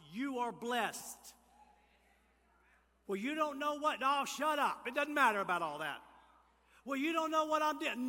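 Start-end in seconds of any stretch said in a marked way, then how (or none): 3.1-4.0 higher, louder mimicked protest; mock objection. Preacher mimicking a doubter's excuse, raised mock protest. 4.0-6.0 loud, brusque cut-off; emphatic dismissal. Acted. Preacher silencing an imagined doubter, loud dismissal. 6.8-8.1 high, loud mimicked protest; mock objection. Preacher voicing a doubter's excuse, high mock protest.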